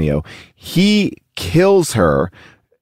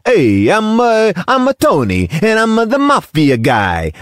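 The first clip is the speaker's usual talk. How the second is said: higher, hammed-up New York mobster; overdone mobster accent, lightly mocked; mock mafia guy; 8 semitones higher than usual. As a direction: play a cartoon mafia guy. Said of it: "he does that for that movie".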